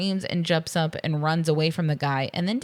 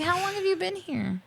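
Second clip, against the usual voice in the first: mock incredulous; 13 semitones higher, 3 dB quieter than usual. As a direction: squeak it out in mock disbelief. Acts out baffled question to elevator stowaway.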